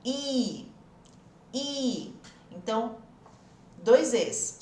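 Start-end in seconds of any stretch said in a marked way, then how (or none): 0.0-0.7 I said as a stretched í; long acute í. Shown as a demonstration. Teacher models the double E sound, long spread í. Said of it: "um I com acento agudo". 1.5-2.1 I said as a stretched í; long acute í. Shown as a demonstration. Teacher models the double E sound, long spread í. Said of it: "um I com acento agudo".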